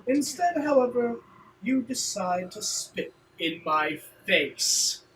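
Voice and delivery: slow, theatrically affronted rival; slighted rival. Acted. Rival accusing someone who spat on him, slow theatrical affront. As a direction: draw out each grievance with wounded pride.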